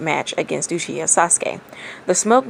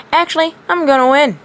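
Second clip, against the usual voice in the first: cocky, a little louder and higher; smug; 9 semitones higher, 5 dB louder than usual. A narrator's reading. Naruto tells Neji he will win, smug and cocky. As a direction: savor the sure win. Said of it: "Naruto said smugly".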